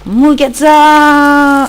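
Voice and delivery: loud high shout, last vowel held long; drawn-out, high-pitched war cry; battle cry. Pretend. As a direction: yell it out and stretch the ending. Sudden battle cry while playing.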